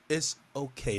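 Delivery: normal talking voice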